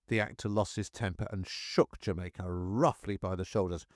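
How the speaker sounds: ordinary delivery, nothing marked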